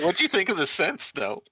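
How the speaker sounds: ordinary delivery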